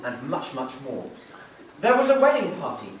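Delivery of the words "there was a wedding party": high, loud cheerful telling; happy explanation. Acted. Husband telling his wife the brooms sold, cheerful. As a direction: share the good news. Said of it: "he explained".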